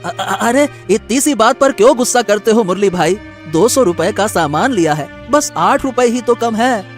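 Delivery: stammering start, then a mild plea; pleading. Acted. Customer short of eight rupees to shopkeeper, pleading. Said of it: "आनंद ने बहुत विनती की".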